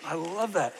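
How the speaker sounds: ordinary talk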